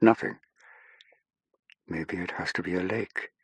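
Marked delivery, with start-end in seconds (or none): 1.9-3.3 very soft, private murmur; murmuring to herself. A narrator's reading. Saffron to herself, thinking about a lake, murmured. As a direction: say it under your breath. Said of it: "she murmured to herself".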